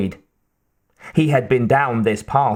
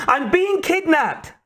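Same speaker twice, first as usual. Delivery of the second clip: slow, very high-pitched scream. A narrator's reading. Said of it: "Kate screamed".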